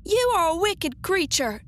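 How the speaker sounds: put-on high, indignant little-girl outcry; angry cry. A narrator's reading. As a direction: cry out in a girl's anger. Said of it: "cried Dorothy".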